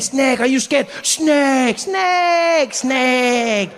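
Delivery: put-on high, stretched French-accented taunt; mocking French accent. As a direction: jeer back and forth as a Frenchman. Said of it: "He's like".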